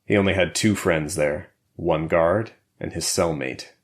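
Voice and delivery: plain reading voice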